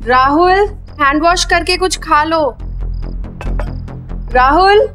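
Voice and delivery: mother calling son. Acted. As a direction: call him from another room.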